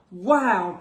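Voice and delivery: usual speaking voice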